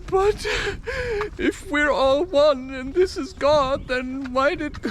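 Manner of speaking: high-pitched, mock-hurt questioning; plaintive protest. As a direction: plead in a high put-on voice. Acts out the punched person's protest.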